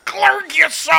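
high, loud laughing gurgle; gurgling dying shout. Pretend. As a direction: scream the gurgled words through laughter. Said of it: "What's his final words?".

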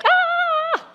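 high-pitched comic scream of panic; mock scream. Preacher playing Elijah reacting to Jezebel's threat, mock scream. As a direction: scream like a scared prophet.

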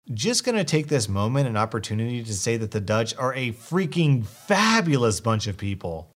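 plain reading voice

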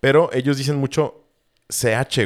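normal talking voice

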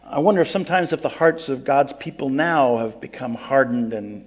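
ordinary talk, nothing marked

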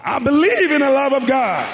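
high, very loud shouted declaration; full shout. Shown as a demonstration. Preacher leading congregation in declaring faith, shouting it out loud. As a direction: shout it out at the top of your voice. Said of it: "Shout it out loud now".

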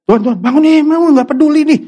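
high, loud panicked pleading, words rushing; panicked plea. Retold or imitated. Disciples in the storm waking their master, panicked and loud. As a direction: shake the sleeping master awake in alarm. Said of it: "Dia pasti panik dong".

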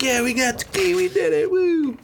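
high excited whoop; mock cheer. Two brothers cheering over the key, mock excited whoop. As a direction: cheer like they just won. Stoked characters cheering after win.

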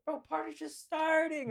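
put-on high, slow drunken whine; mock-drunk, high-pitched plea; drunken protest. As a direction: drag it out like a tipsy partier. Drunk guest protesting bedtime.

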